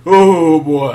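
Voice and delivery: normal talking voice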